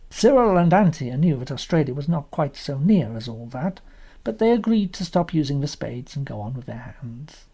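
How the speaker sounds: usual speaking voice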